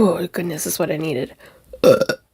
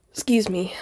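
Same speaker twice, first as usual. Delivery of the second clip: ordinary delivery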